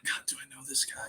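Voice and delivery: breathy private whisper, unsure; whispered to himself. Retold or imitated. Catcher wondering if he knows the fan yelling, whispered. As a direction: hush it like a thought you don't want heard. Unsure thought when fans shout his name.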